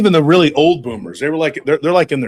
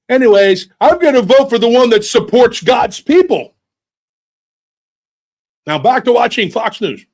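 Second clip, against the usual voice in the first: put-on higher, louder old man; dismissive grandpa; 5 semitones higher, 5 dB louder than usual. Grandpa ending the argument, higher and louder. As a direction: brush it off like a stubborn grandpa.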